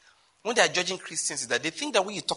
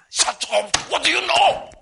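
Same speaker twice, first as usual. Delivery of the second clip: scornful retort; 9 semitones higher, 8 dB louder than usual. Retold or imitated. Mocking prayer leaders, scornful retort.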